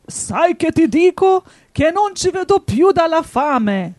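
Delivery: loud, high furious wolf; angry wolf. A narrator's reading. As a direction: roar it out in hungry rage. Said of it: "disse il lupo rabbioso".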